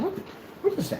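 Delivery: put-on a rough Irish accent, pitched high on the question; imitating his Irish friend, though he admits he can't do the accent; attempted Irish accent. Friend puzzled by the cereal, in a rough imitated Irish accent. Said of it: "I can't do an Irish accent".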